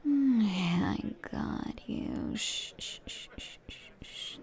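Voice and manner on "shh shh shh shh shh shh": soft, hushed shh sounds; barely audible calming shush; soothing shushing. Acted. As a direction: hush them gently. Soothing listener in roleplay.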